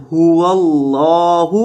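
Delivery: هو اللہ with a rounded-lip ha from the throat. Shown as a demonstration. Teacher demonstrating the throat sound of ha. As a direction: round the lips on the ha of هو. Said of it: "اس کی آواز آتی ہے".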